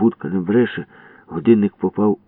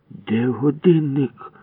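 higher, slow, each word pressed; furious repeat; 8 semitones higher, much slower than usual. Acted. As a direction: grind out the demand. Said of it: "люто повторив Ява". (люто, повільно)

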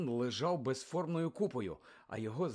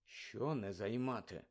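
soft, lower, slow and confused; puzzled; 4 semitones lower, 9 dB quieter, slower than usual. Acted. Harry confused by Moody's order, quiet puzzled question. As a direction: ask quietly, bewildered. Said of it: "спантеличено запитав Гаррі".

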